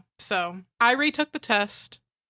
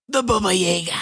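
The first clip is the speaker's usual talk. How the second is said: movie-style Baba Yaga; 3 semitones lower than usual. Acted. Co-host naming the movie assassin, in a dramatic put-on voice. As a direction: say it like a movie legend's title. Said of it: "And that's how they say it".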